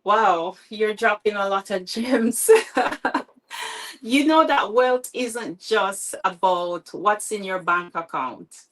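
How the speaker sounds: usual speaking voice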